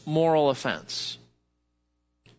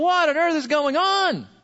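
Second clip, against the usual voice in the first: exasperated disbelief; 10 semitones higher than usual. Retold or imitated. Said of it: "you're going".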